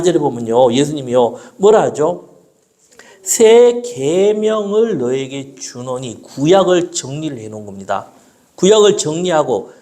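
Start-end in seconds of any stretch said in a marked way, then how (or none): 3.2-6.2 scripture reading. Acted. Reads scripture slowly.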